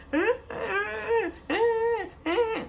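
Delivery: high-pitched mock wailing; put-on bawling, high and drawn out; imitated crying. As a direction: wail out exaggerated crying sobs. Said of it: "just crying".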